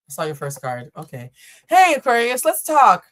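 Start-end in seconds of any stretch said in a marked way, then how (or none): 1.7-3.1 loud, high hail; bold summons. Pretend. Reader opening a talk with Aquarius, bold summons. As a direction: call them in for a talk, loudly. First try at addressing Aquarius.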